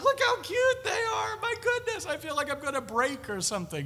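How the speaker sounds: high squealing gush, near tears; gushing over cuteness. Retold or imitated. Preacher gushing over the chapel's little children. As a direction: melt into a doting squeal. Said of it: "I just want to walk around and say".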